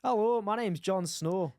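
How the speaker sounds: drawn-out Scottish-accented introduction. Shown as a demonstration. Introducing himself as Jon Snow on request, Scottish accent. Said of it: "in a Scottish accent".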